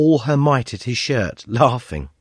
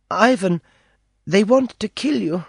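put-on high young girl's voice, urgent warning; a frightened little girl breaking bad news; 10 semitones higher than usual. A narrator's reading. Girl warning the prisoner, high girlish voice. Dina warns Julien of danger.

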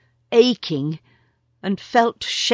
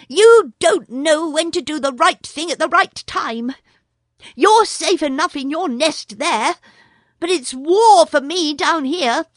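higher, louder urgent mongoose; a brave mongoose cutting in; higher, louder mongoose asking after Nagaina; Rikki Tikki; 9 semitones higher, 5 dB louder than usual. A narrator's reading.

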